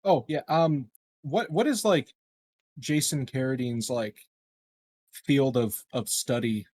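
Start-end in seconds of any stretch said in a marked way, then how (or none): none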